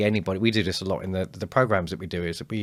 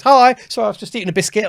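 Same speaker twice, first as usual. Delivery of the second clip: much higher, louder acted greeting; bright surprised greeting; 13 semitones higher, 12 dB louder than usual. Attendee popping onto camera and apologising to the group, bright surprised greeting. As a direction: pipe up suddenly as a caught-out attendee.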